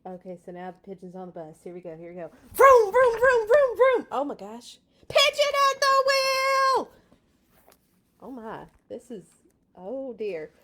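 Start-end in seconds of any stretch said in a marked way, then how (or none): 2.5-4.1 loud high vroom vroom; engine noises. Acted. Pigeon playing driver, loud high engine noises. 5.1-6.9 high, loud slow shout; excited cry. Acted. Pigeon at the wheel, shouting high and loud. Pigeon announces itself driving.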